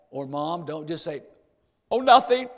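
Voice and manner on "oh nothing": high, loud "nothing's wrong" act; hammed-up evasive reply, pitched up; mock brush-off. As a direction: imitate a child dodging a parent's question. Child brushing off a parent.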